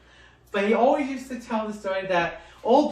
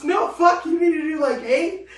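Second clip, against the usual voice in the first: laughing protest; 7 semitones higher, 4 dB louder than usual. Retold or imitated. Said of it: "He goes".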